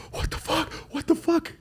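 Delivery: high, strained panic at the goring bull; panicked disbelief. Retold or imitated. Watching the bull gore his guide, high panicked disbelief. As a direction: squeak it out in shocked disbelief.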